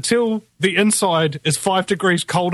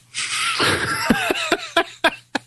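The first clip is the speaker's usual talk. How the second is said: high laugh; 4 dB quieter than usual. Real, not acted. Laughs at own stoner bit.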